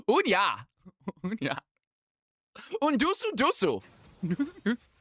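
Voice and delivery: high fake-Finnish babble with a laugh; mock Finnish gibberish. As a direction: babble sounds you think are Finnish. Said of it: "worst attempt at a Finnish accent".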